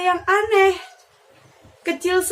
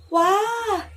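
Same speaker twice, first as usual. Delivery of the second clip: high, admiring bird voice; pigeon voice; 9 semitones higher than usual. Acted. Pigeon marvelling at the deer's antlers, high and admiring. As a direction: gasp in wonder at the antlers.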